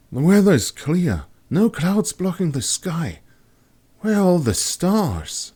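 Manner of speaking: put-on high, curious young woman's voice; wondering aloud at the empty sky. A narrator's reading. Kat gazing upward, puzzled and high-voiced.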